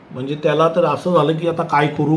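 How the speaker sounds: ordinary delivery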